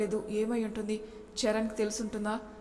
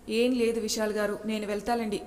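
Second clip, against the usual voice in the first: ordinary delivery, nothing marked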